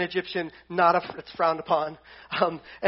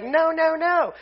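much higher mock refusal; stubborn Pharaoh; 8 semitones higher, 4 dB louder, slower than usual. Pharaoh refuses Moses's demand, hammed-up higher whiny no. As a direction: whine the no like a stubborn king. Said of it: "Pharaoh's gonna be like".

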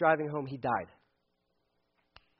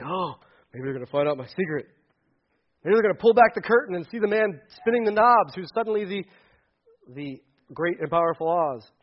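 fretful, rushed worrying voice; a God nervous his secret is out; mock worried God; 3 semitones higher, 11 dB louder than usual. Pastor mocks a worried God, nervous and fretful.

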